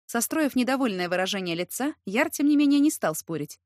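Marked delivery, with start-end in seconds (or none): none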